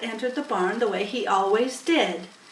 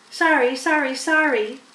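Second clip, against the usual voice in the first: apologetic gander; 5 semitones higher, 6 dB louder than usual. A narrator's reading.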